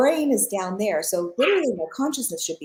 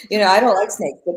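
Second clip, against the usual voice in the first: ordinary talk, nothing marked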